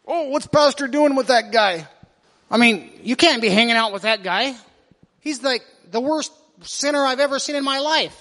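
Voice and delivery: a lightly voiced scandalised churchgoer; mock gossiping congregant. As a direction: gossip as if shocked behind his back. Congregant grumbling about the pastor.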